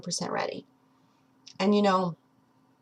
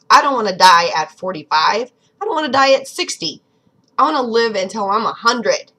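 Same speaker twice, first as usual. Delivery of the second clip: passionate louder declaration; 8 dB louder than usual. Real, not acted. Passionate vow to live long.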